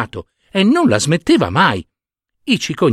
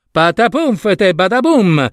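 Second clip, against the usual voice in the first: crash noise; 7 semitones higher, slower than usual. Acted. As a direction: bounce out the tumble like a comic thud. Storks crash down.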